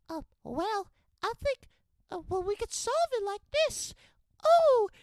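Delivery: very high, squeaky mock of the lead girl. Reviewer mocking the lead actress's classroom line in a very high squeaky voice. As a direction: squeak it out like a ditzy student solving a problem. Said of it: "that's how she actually talks".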